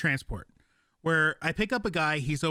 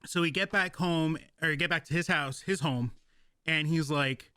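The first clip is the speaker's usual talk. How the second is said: ordinary talk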